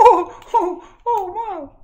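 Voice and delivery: very high, loud squeals; hammed-up high-pitched excitement; thrilled squeaky son. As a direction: squeal with delight. Son thrilled by dad's praise.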